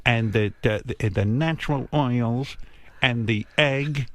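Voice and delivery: slow, drawn-out imitation of a mother's lecture; lecturing mother. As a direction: drag each word out like a mother who won't stop talking. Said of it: "It was, it was a lecture".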